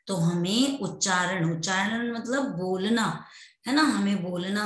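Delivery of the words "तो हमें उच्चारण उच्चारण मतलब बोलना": usual speaking voice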